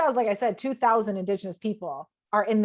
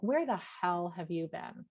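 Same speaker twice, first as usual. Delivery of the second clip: mocking sarcastic. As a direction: sneer la-di-da at him scornfully. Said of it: "That was the energy I was sending him".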